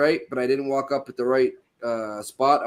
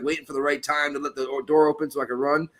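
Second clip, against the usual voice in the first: normal talking voice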